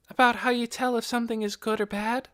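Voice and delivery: put-on high, light girl's voice; a curious young girl asking; young girl Nikki. A narrator's reading. Nikki's lines in the story.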